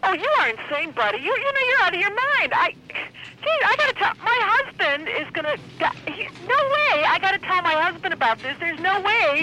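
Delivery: very high, flustered indignation; real worked-up protest; agitated outrage. Real, not acted. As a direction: sputter at someone out of their mind. Outraged at the fake officer.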